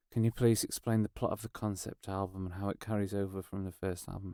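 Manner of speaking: low, very soft mock interview drone; dreary routine questioning. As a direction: run through stock questions under your breath. Voices the same tired concept-album question.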